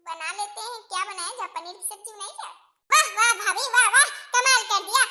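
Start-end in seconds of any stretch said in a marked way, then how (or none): 2.9-5.0 emphatic, biting applause in words; sarcastic praise. Acted. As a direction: praise mockingly to shame someone. Sarcastic praise in family quarrel. (तंज भरी वाहवाही)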